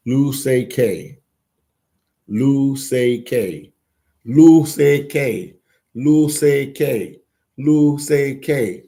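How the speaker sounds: slowly sounding out "Lu Sei K" syllable by syllable; trying Lusaka. Shown as a demonstration. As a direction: drag out "Lu Sei K", each syllable apart. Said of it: "Am I saying it right?".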